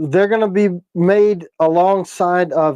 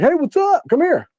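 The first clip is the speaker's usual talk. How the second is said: excited greeting; 12 semitones higher than usual. Retold or imitated. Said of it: "So it's like".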